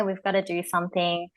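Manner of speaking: ordinary talk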